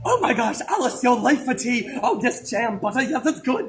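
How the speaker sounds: loud goofy cartoon voice; bubbly silly Wonderland character. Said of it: "you like Alice in Wonderland?".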